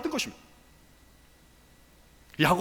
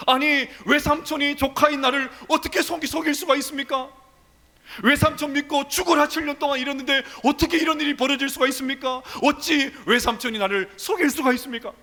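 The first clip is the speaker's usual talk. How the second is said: indignant protest; 6 semitones higher than usual. Acted. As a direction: cry out like a cheated nephew. Jacob protests deception angrily.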